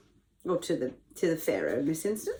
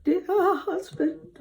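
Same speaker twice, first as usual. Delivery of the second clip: high, slow fake sobbing; badly faked weepy wail, drawn out; pretend crying; 10 semitones higher, slower than usual. Reader shows listeners pretend crying as the lying wife, fake weepy. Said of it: "Can you pretend to cry?".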